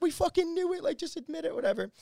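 high-pitched mocking imitation of friends; jeering friends. Friends ribbing him over the car, high mock jeering. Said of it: "They're all laughing their ass off at me".

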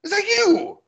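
high-pitched halfling calling out in glad surprise; excited, high little-character voice; delighted halfling. Acted. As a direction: greet a long-lost friend, voice leaping up high. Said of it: "and he says".

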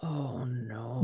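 soft, low whisper; hushed dismay. Real, not acted. Listener reacting to the body in the freezer, hushed dismay.

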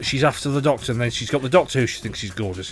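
ordinary talk, nothing marked

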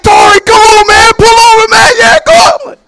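high, loud, laughing shouts; friends calling out. Retold or imitated. Street preachers shouting to Tori at the light, loud laughing calls. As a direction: holler him over gleefully.

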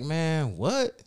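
high, loud baffled what; high startled disbelief; surprised. Retold or imitated. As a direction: caught off guard, half laughing. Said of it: "I'm like".

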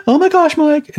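very high, scandalised put-on voice; hammed-up high-pitched disbelief; mock shock. Mimics the shocked listener again.

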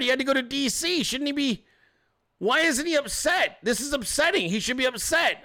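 high-pitched, exasperated disbelief; hammed-up panicky questioning, pitched well up; mock bewilderment. Host voices leftists baffled by the president's confidence, mock bewilderment. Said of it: "you have to be questioning".

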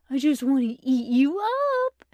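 put-on lightly cutesy baby talk; a hint of doting baby talk; high cutesy voice. A narrator's reading. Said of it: "in a high, cutesy voice".

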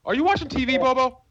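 high-pitched call across to someone. Real, not acted. Host checking on a co-host's background noise, calling out. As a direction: raise the pitch to get their attention.

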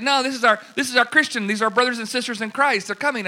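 imitating Amy explaining who is coming; Amy correcting. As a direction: set him straight brightly.

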